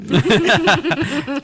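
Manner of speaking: loud burst of real laughter. Real, not acted. Laughs at gas prices.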